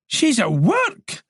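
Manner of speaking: slow high disbelief; failing to hide his shock; incredulous. Acted. George on the phone to Moran, slow incredulous high voice. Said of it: "tried to keep incredulity out of his voice, failed".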